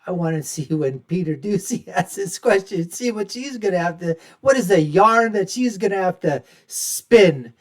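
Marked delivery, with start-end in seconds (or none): none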